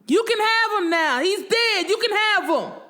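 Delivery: high-pitched, anguished scream. A narrator's reading. Widow to rival on way to parking lot, screaming. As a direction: scream it after her, high and raw with grief. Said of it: "screaming all the way to the parking lot".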